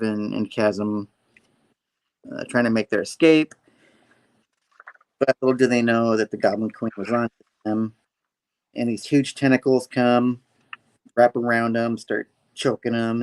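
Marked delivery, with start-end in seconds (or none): none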